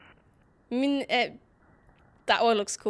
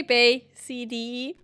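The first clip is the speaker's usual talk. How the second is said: ordinary talk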